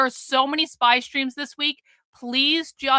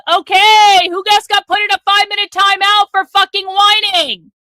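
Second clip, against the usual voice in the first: raised, louder voice calling out a chatter; 7 semitones higher, 10 dB louder than usual. Real, not acted. Streamer loudly calls out the chatter sent to timeout. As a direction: announce it loud to the whole chat.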